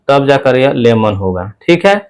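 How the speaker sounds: normal talking voice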